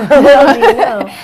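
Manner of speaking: loud words through laughter; real laughing. Real, not acted. Co-host echoing her friend's joke, laughing. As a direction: echo the joke while cracking up. Co-hosts joking together.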